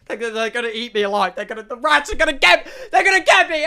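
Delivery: building panic as the rats close in; panicking he'll be eaten alive, climbing into a yell; freaking out. Retold or imitated. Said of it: "I'm freaking out".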